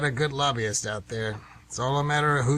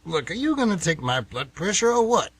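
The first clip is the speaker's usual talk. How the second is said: louder, higher, irritated demand; agitated; 6 semitones higher, 4 dB louder than usual. Acted. Old man snapping at the nurse to hurry up, agitated. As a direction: snap at the nurse to get on with it. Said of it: "You seem a little agitated".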